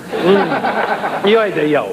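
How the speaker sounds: ordinary talk, nothing marked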